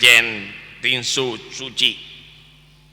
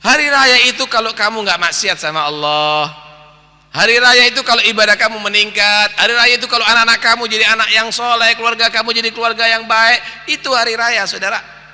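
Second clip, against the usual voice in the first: higher, driving sermon delivery; fiery preaching; 9 semitones higher, 5 dB louder than usual. Real, not acted. Preacher hammering the lesson to the congregation, fervent and loud. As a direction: build the list with rising force.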